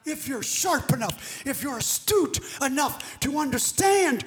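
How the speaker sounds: emphatic preaching. Real, not acted. Preacher challenges the congregation to understand, forceful preaching. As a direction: press each phrase on the congregation.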